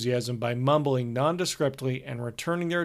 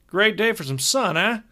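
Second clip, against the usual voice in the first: higher, jovial manager voice; brash, cheerful tour manager; hearty road manager; 7 semitones higher, 5 dB louder than usual. Acted. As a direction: play the chummy older road manager. Narrator voices road manager Hogarth.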